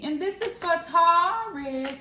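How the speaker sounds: high, slow, stretched-out announcement; singsong intro. Real, not acted. Announcing the Taurus reading in a high, slow singsong. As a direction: draw it out high like a song.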